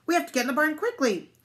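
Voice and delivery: high, fast, urgent dog; hurried, high-pitched alarm; barking dog. A narrator's reading. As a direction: rush out the warning like a sheepdog. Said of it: "barked the dog".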